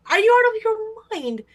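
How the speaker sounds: high, loud disbelief; incredulous outburst. Retold or imitated. Angered by the honking boyfriend, loud disbelief. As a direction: let the shock burst out. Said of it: "to me was like".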